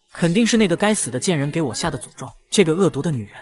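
usual speaking voice

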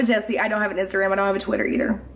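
ordinary delivery

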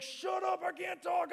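high, strained shout under the bar. Retold or imitated. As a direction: gasp it out while straining. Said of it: "you're like".

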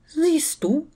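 high, smiling question; cheerful smiling. A narrator's reading. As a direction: smile through it brightly. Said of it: "lächelte Luna". (lächelnd und hoch)

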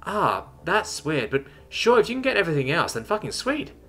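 high-pitched, casual delight; building excitement. A narrator's reading. Terran accepting everything else on offer, excited. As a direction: shrug it off, then get gleefully pleased. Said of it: "now matching Chuck's level of excitement".

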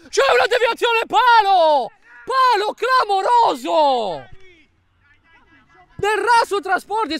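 high, loud shout of the post; shouted goal commentary. Real, not acted. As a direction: yell it as the ball hits the post. Shot hits post, near own goal.

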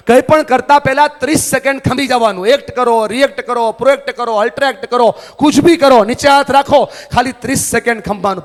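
high, loud sermon drive; fiery motivational preacher; preaching at full force. Acted. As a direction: hammer each phrase to the crowd.

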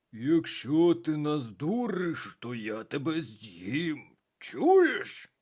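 slow menacing ogre growl; ogre roaring. A narrator's reading. Людожер погрожує бабі Язі, повільний ревучий голос. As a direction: threaten like a hungry ogre. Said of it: "заревів людожер".

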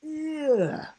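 high, loud grossed-out ugh; disgusted ugh. A narrator's reading. Will licked by the giraffe, loud disgusted ugh. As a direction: recoil from the slobber.

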